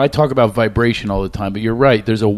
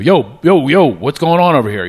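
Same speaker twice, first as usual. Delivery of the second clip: louder, higher Philly call-out; 5 semitones higher, 5 dB louder than usual. Retold or imitated. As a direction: step in and call across the crowd. Stepping in to protect woman.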